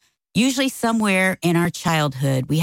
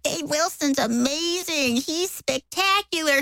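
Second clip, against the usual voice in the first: high, slow, drawn-out adoration; mock an adoring congregation; syrupy high-pitched fawning; gushing fans; 11 semitones higher, 4 dB quieter than usual. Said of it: "everybody in the entire church is like".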